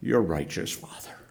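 hushed whispered surrender; barely audible whispered trust; whispered prayer. Acted. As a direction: whisper it as if to God alone. Jesus facing Calvary, praying.

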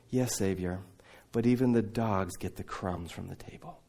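low, soft and humble; humble quiet reply. Retold or imitated. Lebanese widow humbly answering Jesus, quiet and low.

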